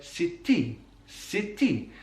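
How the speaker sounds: "city" with a long T, Welsh style; doubled T. Shown as a demonstration. Teacher attempting a Welsh impression, doubled T. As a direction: hold the T between the vowels in "city". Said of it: "doubling of that consonant sound".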